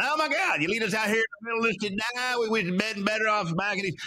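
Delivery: high-pitched, hurried complaint; mock whining. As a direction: imitate a crowd griping in a high, panicky rush. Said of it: "then they start whining".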